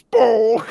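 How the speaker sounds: very high, loud cartoon boom; mock boom. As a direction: yelp it like a silly sound effect. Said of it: "which goes".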